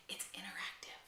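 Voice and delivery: conspiratorial whisper; whispered aside. Real, not acted.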